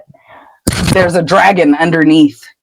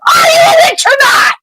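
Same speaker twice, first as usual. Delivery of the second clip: very high-pitched incredulous scream; hammed-up hysterical shouting; losing it. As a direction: yell frantically, drawing the words out. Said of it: "Ron loses it again".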